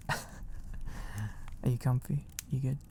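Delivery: ordinary delivery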